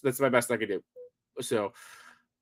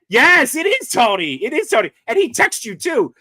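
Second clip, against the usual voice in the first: excited; 7 semitones higher, 7 dB louder than usual. Real, not acted. Excited recognition of Tony.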